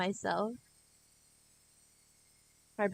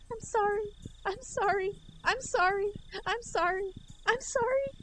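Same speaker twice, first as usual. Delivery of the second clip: much higher, frantic repeated sorries; broken-record pleading; 13 semitones higher than usual. Acted. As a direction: beg over and over.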